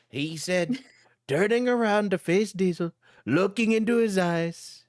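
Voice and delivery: ordinary talk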